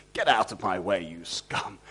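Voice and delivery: low, sneering dismissal; contemptuous master. Retold or imitated. Master ordering a servant aside, low sneer. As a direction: look down on them, cold and low. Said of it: "treat everybody exactly as".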